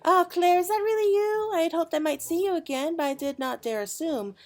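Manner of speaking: dreamy noblewoman. Acted. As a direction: gush over a dream like a delighted lady. Voicing Jahili Alapo dreamer.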